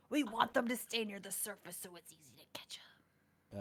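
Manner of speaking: hushed whisper. Real, not acted. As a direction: whisper it to the table. Player explains, hushed.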